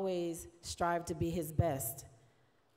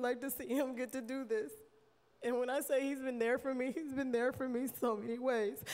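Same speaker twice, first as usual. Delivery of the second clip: higher, tear-choked voice; holding back tears; 7 semitones higher than usual. Real, not acted. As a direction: fight back tears as you speak. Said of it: "And I'm about to cry".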